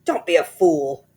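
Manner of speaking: loud sneering husband; Mister Twit voice. A narrator's reading. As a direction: snap at her as a scornful old man. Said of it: "Mister Twit said".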